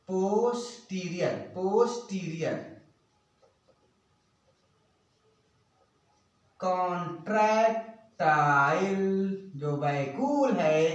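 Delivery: word by word, long pauses; drawn-out, segmented note-giving; slow dictation. Real, not acted. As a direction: dictate slowly as you write. Dictating while writing notes.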